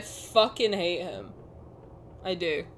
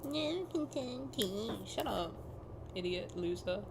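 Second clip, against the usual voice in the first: mocking mimicry; 13 dB quieter than usual. Mocking the villain's line.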